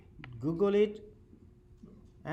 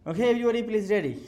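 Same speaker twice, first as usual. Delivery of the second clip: calling out; 6 semitones higher, 9 dB louder, much faster than usual. Real, not acted. Calling the class to attention.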